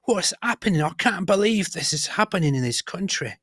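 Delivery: lightly voiced scandalised Brit; mock outraged Briton. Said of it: "would still be like".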